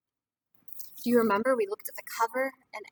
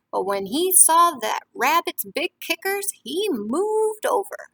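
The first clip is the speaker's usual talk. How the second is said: lively storybook reading to a class; reading to children; 10 dB louder than usual. A narrator's reading. Teacher reading a picture book to the class, lively. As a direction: read it warmly to young listeners.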